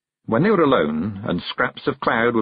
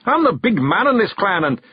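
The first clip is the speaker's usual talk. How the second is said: higher, forceful Scots boast; angry yell; 12 semitones higher, 3 dB louder than usual. A narrator's reading. Rob asserts himself as clan chief, angry yell. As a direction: assert your rank with raised voice. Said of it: "yelled Rob Anybody".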